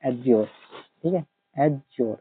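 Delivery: "adjure" said "ajor", silent d; pronunciation demo. Shown as a demonstration. Teacher showing the silent d pronunciation. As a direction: say "adjure" without the d. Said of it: "इसको pronounce करते हैं adjure".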